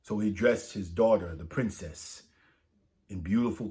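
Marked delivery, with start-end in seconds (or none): none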